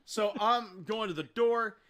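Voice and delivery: ordinary talk, nothing marked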